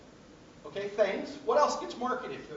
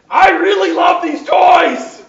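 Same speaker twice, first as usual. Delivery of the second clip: a hammed-up, much louder yell, pitched high and drawn out slowly; mock angry shout; 9 semitones higher, 17 dB louder, slower than usual. Bargaining in a toy shop, a hammed-up angry shout. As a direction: bellow it as fake rage at the shopkeeper. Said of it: "No, get angry".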